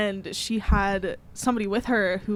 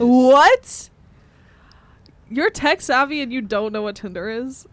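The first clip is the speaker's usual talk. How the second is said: disbelief; 3 semitones higher, 8 dB louder than usual. Real, not acted. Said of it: "He's so lying right now".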